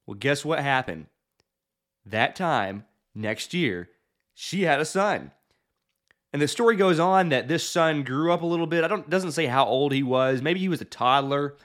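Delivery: ordinary talk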